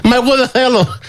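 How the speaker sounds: mock squeal of protest. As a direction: squeal like a sulking member. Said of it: "να φωνάζει τώρα η Σταθοπούλου".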